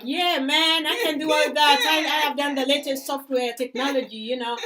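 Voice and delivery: loud, high cocky swagger; brash American candidate. Retold or imitated. Mimicking the overconfident American applicant, loud and cocky. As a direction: boast like you own the interview. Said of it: "the person is like".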